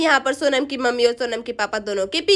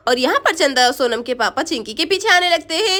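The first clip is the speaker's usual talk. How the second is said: ordinary delivery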